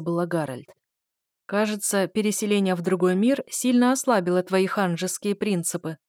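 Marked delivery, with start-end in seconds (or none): none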